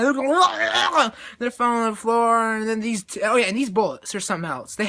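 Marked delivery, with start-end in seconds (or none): 0.4-1.3 high, loud comic wail; hammed-up cries of the shot; imitated battle noise. Fighters falling on the floor, mock battle wail.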